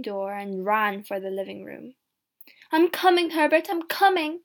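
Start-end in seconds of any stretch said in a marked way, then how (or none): 2.7-4.4 high, loud calling out; urgent raised-voice call; excited cry. A narrator's reading. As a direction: call eagerly to someone far off. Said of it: "she cried".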